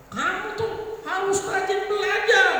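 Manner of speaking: high, slow scolding mother voice; constructive scolding. Shown as a demonstration. Preacher demonstrating constructive anger, high slow scolding. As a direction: scold a child kindly but firmly, high and slow. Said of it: "marahnya tuh pakai kata-kata membangun".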